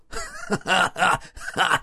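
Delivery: high, wild cackle; mad laughter. Acted. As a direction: burst out laughing at them. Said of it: "расхохотался пленный".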